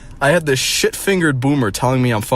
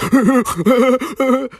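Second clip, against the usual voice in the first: high, silly imitated laugh; mock cringe laugh; 14 semitones higher, 4 dB louder than usual. Mocking the man's laugh, high cringe laugh. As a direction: do a shrill-pitched cringey cackle. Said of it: "let out the most cringe laugh".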